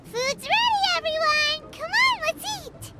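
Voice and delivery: high, eager call to dinner; excited calling out. Acted. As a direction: round everyone up, bursting with excitement.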